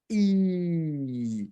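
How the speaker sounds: E stretched as a long sound; teacher modelling the long vowel E; long E demo. Shown as a demonstration. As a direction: draw out E slowly.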